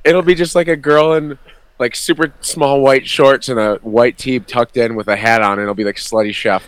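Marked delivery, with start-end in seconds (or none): 0.0-5.5 excited rant. Real, not acted. Ranting about slutty costumes.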